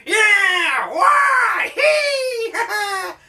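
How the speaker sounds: very high, cackling shriek; a madman laughing at nothing; cackling crazy old man. Retold or imitated. Old man in every story, shrieking with cackles. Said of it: "he's always like".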